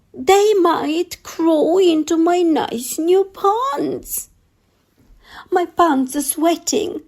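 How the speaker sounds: high, frightened cook voice; scared cook. Acted. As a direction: squeak out the cook's fear, pitched high.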